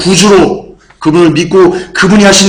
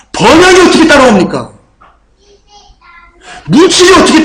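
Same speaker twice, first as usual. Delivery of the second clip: fiery preaching; 8 semitones higher than usual. Acted. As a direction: preach with full force, each question a blow. Preaching at full force.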